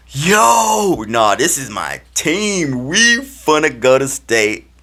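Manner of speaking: high, loud, drawn-out declaration; hyped team pride. Retold or imitated. Player claiming his new team, hyped. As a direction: proclaim it, pumped up.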